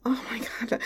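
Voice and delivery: soft intimate whisper; hushed confession. Acted. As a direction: whisper it close to his ear.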